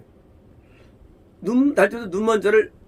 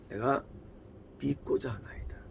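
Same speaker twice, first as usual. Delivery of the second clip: whispered, hushed and humble; 8 semitones lower, 16 dB quieter than usual. Retold or imitated. Preacher voicing the healed man's humble answer in a whisper. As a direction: breathe it out in awe. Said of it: "눈뜬 자의 답변이에요".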